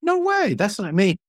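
high, quick 'no way'; playful disbelief, up high; mock denial. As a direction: brush it off with a mock squeal. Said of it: "I said".

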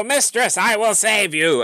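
high, heroic knight cry; hammy stage actor. Acted. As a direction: declaim it like a gallant rescuer. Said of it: "he's gonna go".

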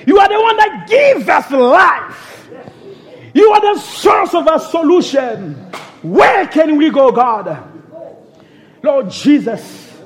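ordinary talk

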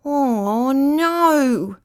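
long, high wail of dismay; almost in tears. A narrator's reading. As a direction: wail it as if about to cry. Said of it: "almost in tears".

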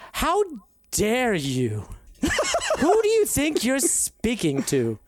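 high, haughty, indignant noble voice; outraged pompous lord, laughing through it; pompous lord demanding respect; Verdon Kemble impression. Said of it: "in perfect Verdon Kemble accent".

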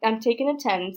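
loud, high exasperated sigh into words; real fed-up frustration; very frustrated. Real, not acted. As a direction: announce a break in exasperation. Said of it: "I'm very frustrated again".

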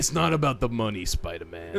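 low, calm Kingpin line; calm menacing drug lord. Pretend. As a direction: menace it quietly, villain style. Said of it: "that meme of Kingpin".

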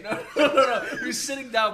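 cracking up mid story; laughing. Real, not acted. Laughing as he fixes his cat story.

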